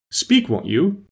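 high-pitched demand for an answer; insistent high cry. A narrator's reading. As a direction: urge them to speak, pitch jumping up. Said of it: "cried the king".

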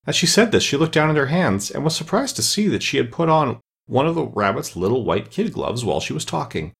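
plain reading voice

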